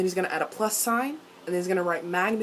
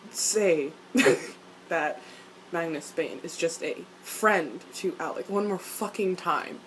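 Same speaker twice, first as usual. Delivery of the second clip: usual speaking voice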